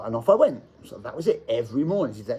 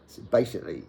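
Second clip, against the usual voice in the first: ordinary delivery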